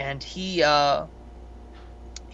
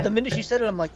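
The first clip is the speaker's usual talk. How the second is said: normal talking voice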